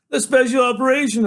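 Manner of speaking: loud, high, exasperated answer; upset insistence. A narrator's reading. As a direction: fire it back, demanding the truth.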